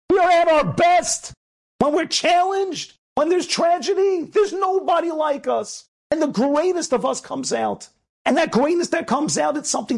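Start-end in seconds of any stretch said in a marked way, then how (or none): 0.1-1.3 high, forceful rallying cry; rousing shout. Real, not acted. Rabbi repeating his teacher's saying to listeners, rousing shout. 1.8-7.9 high, slow, emphatic exhortation; impassioned preaching. Real, not acted. Rabbi rallying listeners in tragedy, impassioned preaching.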